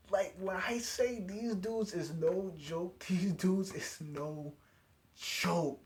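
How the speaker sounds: slow, drawn-out exclamation; stunned hype, dragging each word; emphatic amazement. Real, not acted. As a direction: stretch the words out in emphatic disbelief.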